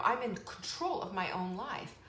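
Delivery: normal talking voice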